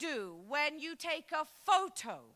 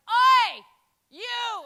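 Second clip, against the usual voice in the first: loud, high "Oi, you!"; builder shout; 9 semitones higher, 15 dB louder than usual. Retold or imitated. Builder on tea break calling to a passing woman, loud shout. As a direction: call out sharply from across the street. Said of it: "one of them went".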